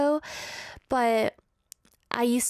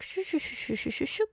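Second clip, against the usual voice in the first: quick, soft repeated shushing whooshes; speed-reading noise; 3 semitones higher, 5 dB quieter than usual. Retold or imitated. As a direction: imitate eyes zipping across a page. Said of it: "that one phase where I was like".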